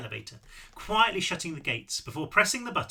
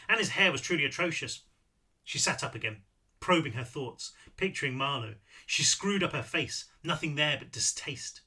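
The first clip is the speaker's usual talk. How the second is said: ordinary delivery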